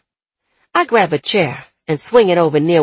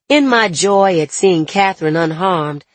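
plain reading voice

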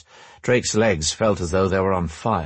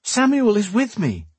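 shouted; 13 semitones higher than usual. A narrator's reading. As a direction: call it out high to stop him. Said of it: "she shouted".

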